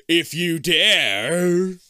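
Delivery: slow, drawn-out spooky voice; creepy announcer, very slow; spooky dare. Acted.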